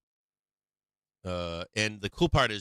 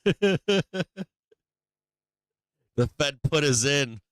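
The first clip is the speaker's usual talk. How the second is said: laughing taunt; 6 semitones higher, 5 dB louder, slower than usual. Real, not acted.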